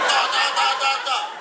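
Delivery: high rapid-fire "ta ta ta"; high-pitched comic zapping; mock laser noise. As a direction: imitate a laser blasting away. Imitates laser-jet cleaning.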